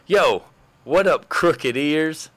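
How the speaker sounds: high, slow, fumbling pickup line; drawn-out clumsy version of the line; awkward pickup attempt. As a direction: try to sound smooth and fail. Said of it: "it'd be something like this".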